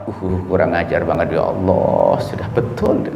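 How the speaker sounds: low, slow, resentful mutter under the breath; a low, slow half-whispered grudge; mock grumble. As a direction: grumble it slowly as if stewing. Retold angry grumble at neighbour.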